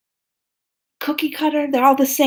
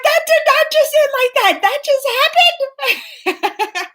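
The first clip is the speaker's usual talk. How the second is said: much higher, loud disbelief; 4 dB louder than usual. Retold or imitated. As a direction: refuse to believe it. Disbelief at the film ending.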